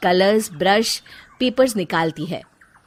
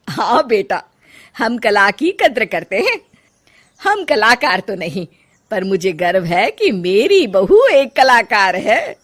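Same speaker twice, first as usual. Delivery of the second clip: loud, swooping declaration of pride; proud mother-in-law; 6 dB louder than usual. Acted. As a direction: proudly encourage the daughter-in-law.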